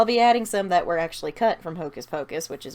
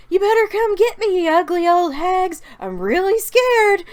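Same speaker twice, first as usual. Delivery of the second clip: put-on high, loud kid's taunt; taunting call; 11 semitones higher, 8 dB louder than usual. Kevin baiting the witches from the doorway, taunting call. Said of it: "Kevin calls them from the doorway".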